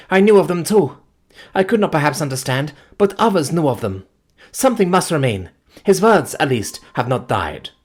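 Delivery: high, loud grieving plea; anguished pleading. Acted. Grieving fiancée pleading with the narrator, anguished. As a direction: beg for something to remain.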